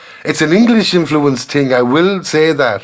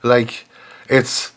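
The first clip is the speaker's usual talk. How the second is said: ordinary delivery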